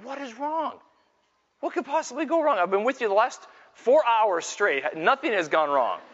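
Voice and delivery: retold dad at his wits' end; exasperated father. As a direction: plead in comic disbelief, voice pushed up high. Father exasperated at crying son.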